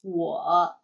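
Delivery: 我 low and dipping; third tone. Shown as a demonstration. Teacher models 我 for learners, in third tone. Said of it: "with third tone".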